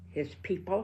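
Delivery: usual speaking voice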